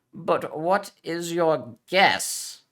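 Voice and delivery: slow, loud, teeth clenched; through gritted teeth. Acted. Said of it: "said through gritted teeth".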